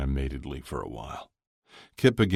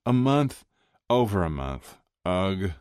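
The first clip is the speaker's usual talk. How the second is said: higher, mildly despairing groan; moaning; 5 semitones higher, 3 dB louder than usual. A narrator's reading. Maria tallies her dry spell, moaning lightly. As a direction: groan over the count, starting high. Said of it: "threw her head back and moaned".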